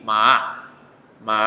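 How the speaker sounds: rising Mandarin second-tone "Ma". Shown as a demonstration. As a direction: lift each "Ma" upward like a question. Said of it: "Agak tinggi ke paling tinggi".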